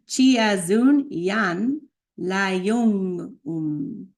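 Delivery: spoken, with pauses between the parts; careful piece-by-piece pronunciation demo; slow spoken breakdown. Shown as a demonstration. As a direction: speak it slowly, word by word. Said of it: "This one's a little tricky".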